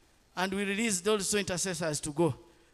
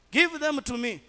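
a moderate mock shout, higher and louder; retold shout; 5 semitones higher, 5 dB louder than usual. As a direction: bark it back the way the uncle yelled it. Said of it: "one of them was shouting at me".

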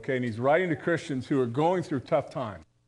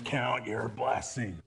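muttered reminder; 6 semitones lower, 7 dB quieter, slower than usual. Retold or imitated. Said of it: "we'd be out raking leaves".

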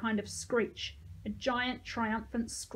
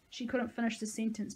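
ordinary delivery

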